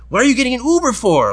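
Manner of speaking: high mock-indignant questioning; pushy bar-goer impression; imitated nagging woman. Imitating woman confronting him at bar.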